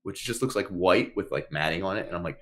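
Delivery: ordinary delivery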